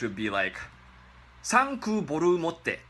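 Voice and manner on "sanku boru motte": mock Japanese accent. Shown as a demonstration. Teacher mocking English in a Japanese accent. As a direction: thank you very much in a higher mock Japanese accent. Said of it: "That's what it sounds like".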